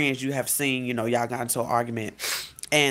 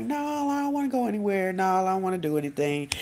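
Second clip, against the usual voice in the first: hammed-up moping no; mock whiny refusal; 6 semitones higher than usual. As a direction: start way up high and sink through the refusal. Acting out refusing to go out.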